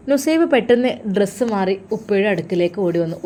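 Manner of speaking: ordinary delivery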